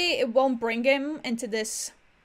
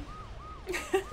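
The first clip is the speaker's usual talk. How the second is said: breathless giggle; 8 semitones higher, 10 dB quieter than usual. Real, not acted. Laughs at herself.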